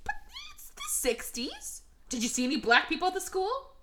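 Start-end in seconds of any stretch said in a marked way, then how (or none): 0.0-1.8 tiny, very high, soft squeak; squeaky protest. Acted. Host feebly excuses the film to co-host, squeaking softly. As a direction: squeeze it out slowly as a faint high whine. Mock-pleading excuse in argument.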